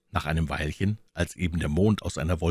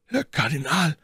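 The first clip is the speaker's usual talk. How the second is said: astonished; 10 semitones higher than usual. A narrator's reading. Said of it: "rief der Musketier verwundert".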